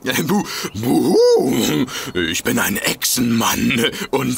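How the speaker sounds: langsam, dumpf verstellte Gruselstimme; gespieltes Monster. Pretend. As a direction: Echsenmann spielen, um zu erschrecken. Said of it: "Sehr witzig". (leicht verstellte Monsterstimme mit Buhu)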